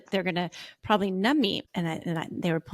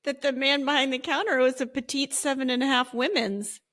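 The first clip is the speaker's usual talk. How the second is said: drip with irony; sarcastic; 5 semitones higher than usual. Real, not acted. Ironic remark, high far voice.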